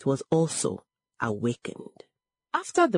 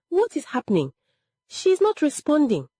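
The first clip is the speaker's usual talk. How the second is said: high, alarmed exclamation; panicked; 10 semitones higher, 3 dB louder than usual. A narrator's reading. Siblings see their mother failing, panicked. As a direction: pitch it up in sudden alarm. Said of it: "Panic entered the room".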